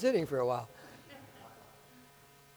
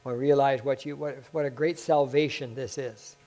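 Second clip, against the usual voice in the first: closing prayer. Acted.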